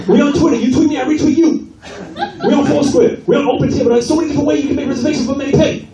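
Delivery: high, fast, ranting salon owner; exasperated salon owner. Retold or imitated. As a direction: rattle it off in a shrill over-the-top rant. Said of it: "He's like".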